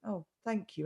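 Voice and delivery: very soft, subdued thanks; mollified. Retold or imitated. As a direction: imitate someone disarmed by kindness. Mollified man thanks her.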